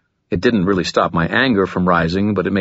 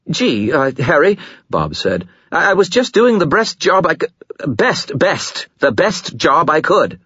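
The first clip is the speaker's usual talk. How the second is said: stammering fear; 10 semitones higher than usual. Acted. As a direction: stammer out the plea.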